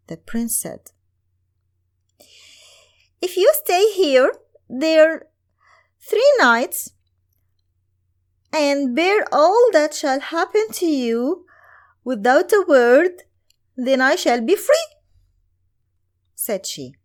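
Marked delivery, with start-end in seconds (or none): 3.2-14.9 much higher, louder maiden setting the terms; an enchanted girl pleading for rescue. Acted.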